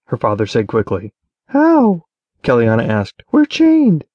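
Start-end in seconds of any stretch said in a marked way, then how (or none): 1.5-2.0 put-on high, loud, alarmed young woman's question; a daughter's sharp, worried question; Keliana's voice, startled. A narrator's reading. Daughter asks her father, high and alarmed. 3.3-4.0 put-on high, loud protest from the young woman; Keliana's voice, alarmed. A narrator's reading. Keliana reminds her father, high and urgent.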